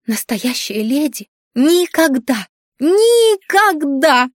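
second никогда stretched very slow, high and loud; scandalised governess hammers никогда, slow and loud; stress on никогда. A narrator's reading. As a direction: drag out the repeated никогда in a high wail. Governess scolds, stressing never.

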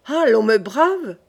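slow outraged cry, swooping in pitch; indignant cry. A narrator's reading. Charles reacts to his mother defying him, outraged cry. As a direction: stretch it into an affronted exclamation. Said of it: "s'écria Charles".

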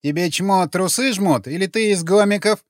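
high, contemptuous retort; snorting scorn. A narrator's reading. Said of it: "фыркнула она".

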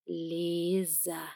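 "Лиза" said slowly and carefully; careful child-like. A narrator's reading. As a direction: sound out "Лиза" like a small child. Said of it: "старательно проговорил он, как маленький ребёнок".

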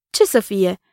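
plain reading voice

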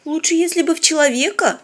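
put-on high, reproachful girl's voice. A narrator's reading. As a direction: chide him for his remark. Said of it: "сукоризной уточнила Полина". (с укоризной)

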